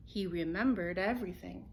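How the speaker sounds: plain reading voice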